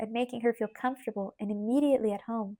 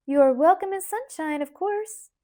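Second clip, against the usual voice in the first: warm greeting; 7 semitones higher, 6 dB louder than usual. Acted. Aunt Millie greets warmly.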